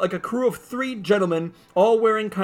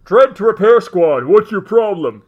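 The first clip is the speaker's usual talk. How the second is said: loud, commanding radio call; Judge Dredd; 7 dB louder than usual. Acted. Dredd radioing the repair squad, loud official demand. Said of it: "Dredd's t-, voice comes out of it".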